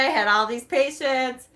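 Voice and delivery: ordinary talk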